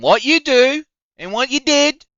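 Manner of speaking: squeaky high mock of the sender. Ridiculing the harasser's apology, mock squeaky voice. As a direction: pitch it way up to ridicule him. Mocking the text's wording.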